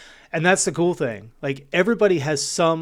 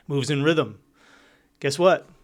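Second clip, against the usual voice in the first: ordinary talk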